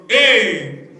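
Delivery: ordinary talk, nothing marked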